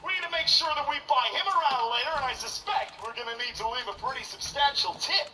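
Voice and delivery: high, upbeat American office voice; American accent. Retold or imitated. Coworker praising the boss's bar tab, high upbeat American accent. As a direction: gush like a cheerful American coworker. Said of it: "In America".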